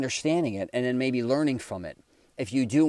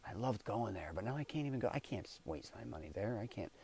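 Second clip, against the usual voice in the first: much softer, let-down grumble to yourself; mopey mutter; 12 dB quieter than usual. Retold or imitated. Broke driver passing a favorite restaurant, softly dejected. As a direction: sigh it out like you can't afford the treat. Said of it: "You're like".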